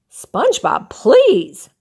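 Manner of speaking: barked. Acted. Squidward snapping at SpongeBob to stop, sharp bark. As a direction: cut him off, fed up. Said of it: "barked Squidward".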